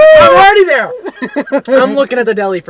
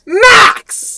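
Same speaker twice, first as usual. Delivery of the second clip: shouting; 13 semitones higher, 5 dB louder than usual. Real, not acted.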